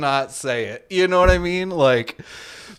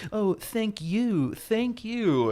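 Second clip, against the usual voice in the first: high, slow, syrupy thank you; mocking a passive aggressive vendor with sugary thanks; sarcastic fake thanks; 8 semitones higher, 4 dB quieter, much slower than usual. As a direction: drip fake gratitude that means get lost. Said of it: "I meant to say, "Fuck you".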